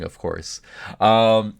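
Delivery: ordinary talk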